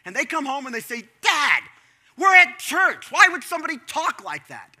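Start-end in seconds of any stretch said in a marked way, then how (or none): 1.2-4.8 put-on higher and much louder, outraged child; indignant kids. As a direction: play the shocked kid who can't believe it. Kids complaining to their dad.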